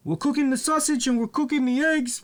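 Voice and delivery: high, fast, repetitive mock wrestler voice; imitating La Parka. Mocking the wrestler repeating himself on his cooking show, in a high fast voice. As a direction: parrot the dumb line in a high, fast voice. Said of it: "he's speaking his own language".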